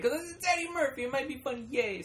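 high, quick and giddy; squealing childish glee, fast; excited kid. Retold or imitated. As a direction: bounce like a kid who found the good movie. Kid excited about Eddie Murphy movie.

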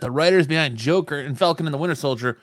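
loud, high-energy headline reading; news read. Real, not acted. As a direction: announce it like breaking entertainment news. Reads news item.

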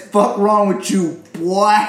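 slow, hammed-up Southern accent. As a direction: imitate a Southern player squaring up, drawn out. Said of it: "That's how he says it".

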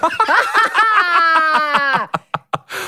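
long high laugh tipping into a sob; helpless wailing laugh. Real, not acted. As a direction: collapse into a drawn-out wail of laughter. Breaks down over the argument.

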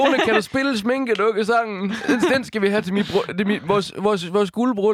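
put-on high, slow old man asking; lightly voiced elderly husband. Imitating old man at anniversary.

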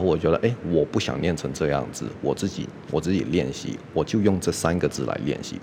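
normal talking voice

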